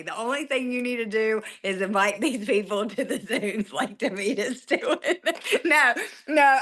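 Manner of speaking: words broken by a laugh; laughing while talking. Real, not acted. Coach to team, chuckling through the advice.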